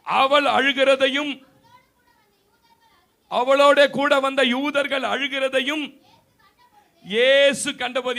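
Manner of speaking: loud, slow, weighty scripture reading; preaching at full force. Acted. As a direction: proclaim each word slowly to the church. (உரத்த, மெதுவான பிரசங்க வாசிப்பு)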